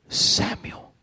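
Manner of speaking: breathy whispered "Samuel" in the night; whispered call. Acted. God's voice calling at night.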